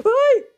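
shrill high shriek of fright; terrified scream. Real, not acted. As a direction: scream as the monster grabs you. Caught by monster.

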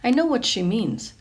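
ordinary delivery, nothing marked

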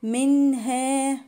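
"منها" with a distinct n before h; clear nun. Shown as a demonstration. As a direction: sound the ن of "منها" cleanly, slowly chanted. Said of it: "إظهار للنون".

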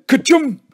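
high, loud play-acted sneeze; mock sneeze. A narrator's reading. Joe pretending to be Billy on peppered worm, mock sneeze. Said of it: "Then he'd sneeze again".

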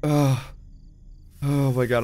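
tense, breath held in; talking over a held breath; holding breath. Real, not acted. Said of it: "I'm holding my breath".

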